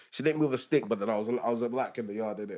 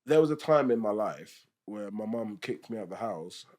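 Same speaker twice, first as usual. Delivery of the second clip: ordinary delivery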